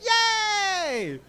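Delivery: high, loud squeal of delight; squeal of joy. Retold or imitated. Cheering when the wind saves their bubble, high squeal of joy. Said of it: "We're like".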